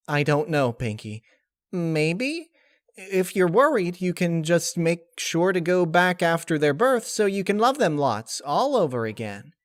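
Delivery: high, light and breezy; high cheerful spirit voice; chirpy Laughter voice. Acted. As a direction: shrug it off brightly.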